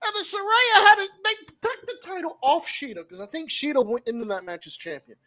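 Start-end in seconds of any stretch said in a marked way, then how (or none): 0.1-3.1 higher, louder, slowed shout on belt; exasperated shout. Real, not acted. Fan shouting how the title should have gone, exasperated yell. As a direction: yell the better idea at them.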